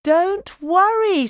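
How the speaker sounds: high, gentle soothing mother; reassuring mum. A narrator's reading. Mum reassuring Splat about doctors, gentle. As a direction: comfort a nervous child.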